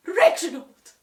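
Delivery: loud alarmed gasp of a name. A narrator's reading. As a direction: cry out in shock. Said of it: "gasped Missus Mossy".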